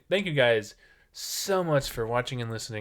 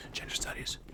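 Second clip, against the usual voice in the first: hushed teasing whisper; whispered aside; 10 dB quieter than usual. Real, not acted. Host whispering the name of the major, teasing whisper. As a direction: drop the words into a whisper.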